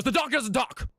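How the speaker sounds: high-pitched, hurried protest; alarmed farmer. Acted. Farmer cutting off the ventriloquist about his donkey, high alarmed blurt. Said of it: "And the guy goes".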